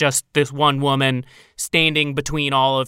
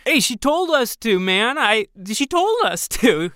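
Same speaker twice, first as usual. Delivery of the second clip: high defensive protest; 7 semitones higher than usual. Acted. Runaway defending himself.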